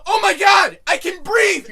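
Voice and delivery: loud, high triumphant cry; hammed-up yell of reprieve; shouted relief. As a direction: re-enact a huge burst of relief. Acts out relief after choking.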